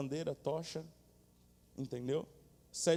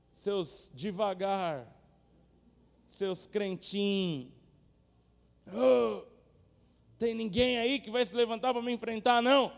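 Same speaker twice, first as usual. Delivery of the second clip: loud, high, slow mocking bellow; taunting giant; 6 semitones higher, 7 dB louder, slower than usual. Goliath taunting the Israelites, loud slow mocking bellow. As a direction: jeer at them like a giant bully.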